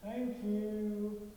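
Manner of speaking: mock cheerful thanks. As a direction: chirp it happily like he got his way. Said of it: "I'd hear a cheerful".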